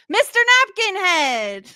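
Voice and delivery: drawn-out host introduction; announcer intro. Pretend. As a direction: present him like a showman.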